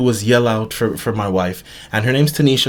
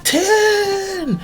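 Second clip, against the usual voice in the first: yelling a name. Retold or imitated. Husband yelling for his wife in the crashed bus. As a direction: call out for her with everything. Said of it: "I'm just yelling out".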